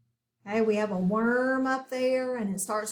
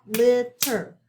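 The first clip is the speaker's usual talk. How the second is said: LITTER split into two beats, LIT and TER, both punched louder; clapped-out syllables; 7 dB louder than usual. Shown as a demonstration. Teacher clapping out two syllables, LITTER broken into loud separate beats. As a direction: say LITTER syllable by syllable, a small gap between. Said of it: "so clap it out".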